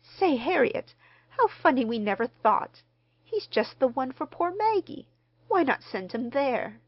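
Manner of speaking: high, loud, excited bright idea; sudden exclamation. A narrator's reading. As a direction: burst out as the idea strikes. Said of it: "With a sudden exclamation".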